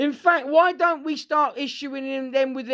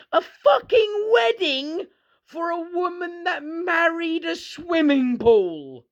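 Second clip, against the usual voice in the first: disbelief; 6 semitones higher, slower than usual. Real, not acted. Ranting disbelief at odd wedding.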